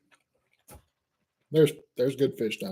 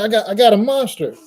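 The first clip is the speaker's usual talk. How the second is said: higher, louder mock call across the lake; retold shout; 7 semitones higher, 5 dB louder than usual. Kayaker shouting his catch across the lake, mock holler. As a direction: boast "I got a monster" as a light hammed-up holler. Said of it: "he's screaming this to me from across the lake".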